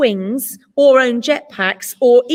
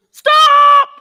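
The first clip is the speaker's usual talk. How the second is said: shout; 13 semitones higher, 10 dB louder than usual. A narrator's reading. Bear shouts to stop attack.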